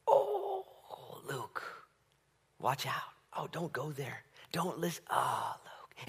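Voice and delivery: soft, hushed warning, partly whispered; gently begging someone not to go there; tender murmured plea; pleading Holy Spirit. Pretend.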